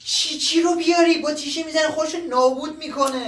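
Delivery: high, panicked objection; alarmed protest. Acted. Young man fearing the old man will hurt himself, alarmed. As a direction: pitch it up in sharp alarm.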